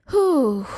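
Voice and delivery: big audible breath out, "whew"; demonstrated exhale. Shown as a demonstration. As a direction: blow the breath out through the mouth. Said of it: "let it out from the mouth".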